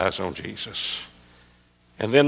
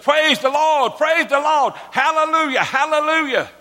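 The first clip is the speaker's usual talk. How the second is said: very high, loud tongue-tied rejoicing; exultant high-pitched mock praise shout; Uncle Buddy Robinson; 4 dB louder than usual. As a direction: imitate an overjoyed preacher jumping up, pitch sky-high. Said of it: "old Uncle Buddy jumped up and said".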